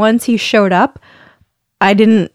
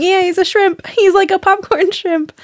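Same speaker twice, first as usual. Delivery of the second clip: gushing; 10 semitones higher than usual. Real, not acted. Gushing over tiny baby.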